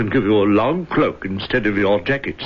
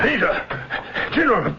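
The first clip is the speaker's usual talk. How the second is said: urgent high shouting; calling out; 11 semitones higher than usual. Acted. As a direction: call out to them from outside. Servant bursts in calling.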